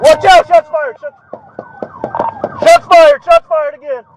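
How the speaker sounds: panicked yelling under fire; urgent shout. Real, not acted. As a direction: yell a warning. Officer shot at, yelling.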